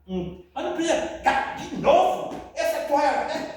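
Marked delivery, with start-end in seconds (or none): 1.2-3.5 slow, reproachful nag about the towel; nagging scold. Pretend. Spouse scolding over the towel again, slow nagging tone. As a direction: drag it out like a tired complaint. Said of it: "Aí você não vai conseguir nada".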